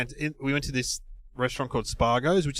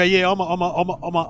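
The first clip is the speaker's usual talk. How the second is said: imitated starstruck stammer, slightly raised pitch; stuttering; 4 semitones higher than usual. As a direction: act out getting stuck on the words. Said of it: "I'll start stuttering".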